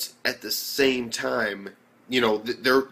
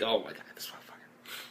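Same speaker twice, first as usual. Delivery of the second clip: softer, breathy disbelief; stunned whisper; 2 semitones lower, 11 dB quieter than usual. Retold or imitated. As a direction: whisper it under your breath, amazed. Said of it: "And I was like".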